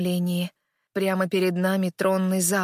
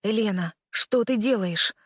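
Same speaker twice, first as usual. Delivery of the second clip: ordinary delivery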